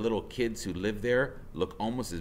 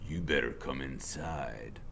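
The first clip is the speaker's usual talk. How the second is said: low, soft, slow threat; menacing biker; 8 semitones lower, 7 dB quieter than usual. Acted. Biker luring kids inside, low and slow. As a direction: say it quietly, a trap. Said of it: "he says".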